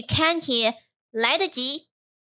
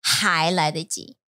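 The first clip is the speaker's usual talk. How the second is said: 还来得及 slow and a little lower; slow teaching demo; 4 semitones lower than usual. Shown as a demonstration. Teacher repeats the phrase for the learner, slowly. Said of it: "That 还 means there's still time".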